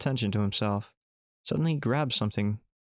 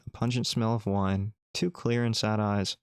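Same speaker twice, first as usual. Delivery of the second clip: usual speaking voice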